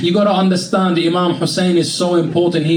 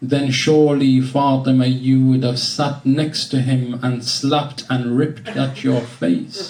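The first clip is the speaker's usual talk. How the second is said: elegy recitation; 3 semitones lower than usual. Acted. Recites elegy verses to Fatima.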